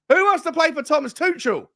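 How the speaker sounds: scornful jeering question; mocking rhetorical challenge. Real, not acted. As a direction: throw it out as a dare. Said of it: "repeated louder".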